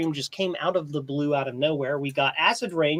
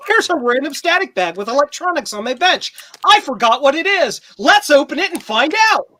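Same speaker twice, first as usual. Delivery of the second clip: mock show host; 7 semitones higher, 7 dB louder than usual. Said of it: "It's like".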